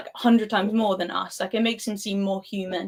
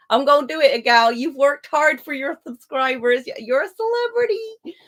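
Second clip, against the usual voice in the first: playful insistence; 5 semitones higher, 5 dB louder than usual. Real, not acted. Insisting to a guest he's famous.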